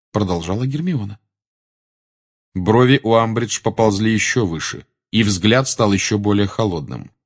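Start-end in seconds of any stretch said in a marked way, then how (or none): none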